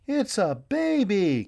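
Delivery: high, slow delighted cry; children crying out at the baby; excited cry. A narrator's reading. Children spotting the baby, high excited cry. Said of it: "cried the children".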